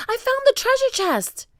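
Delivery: very high, loud announcement; excited cry. A narrator's reading. Jack telling Annie about treasure, crying excitedly. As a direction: cry out a thrilling find. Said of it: "cried Jack".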